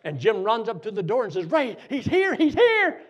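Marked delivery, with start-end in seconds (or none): 1.5-3.0 high, loud excited cry; excited shouting. Retold or imitated. Jim running up to the door, excited shouting. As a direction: call out the news, pitch leaping up.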